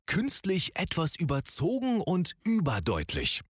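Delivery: exaggerated, overly crisp articulation; over-enunciate every consonant on purpose; overarticulated. Shown as a demonstration. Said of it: "einmal überartikuliert sprechen".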